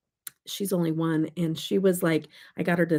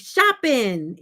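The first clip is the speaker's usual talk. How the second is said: put-on higher, louder little-kid answer; raised, sassy reply; sassy toddler; 6 semitones higher, 5 dB louder than usual. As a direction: imitate a sassy toddler announcing it. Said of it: "She's so sassy".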